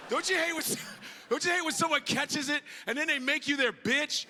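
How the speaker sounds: ordinary talk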